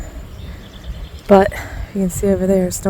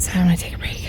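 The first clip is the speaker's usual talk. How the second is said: whispering; 6 dB quieter, much faster than usual. Real, not acted. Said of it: "it's hot".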